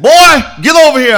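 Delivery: high, loud imitated yell; retold yell. J D calling a boy over in the projects, loud and high. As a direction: bark it across the street like J D. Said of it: "He said".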